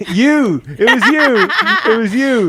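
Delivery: loud, high, laughing accusation; gleeful teasing accusation. Real, not acted. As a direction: shriek it through laughter, teasing.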